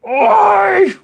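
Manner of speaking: a sudden furious yell, very high and loud; angry shout. Retold or imitated. A dad yelling at his son. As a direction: yell at the son who hit him. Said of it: "I was like".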